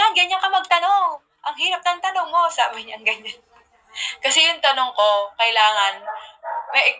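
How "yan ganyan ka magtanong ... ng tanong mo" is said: mock exasperated. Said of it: "Tapos sabi niya".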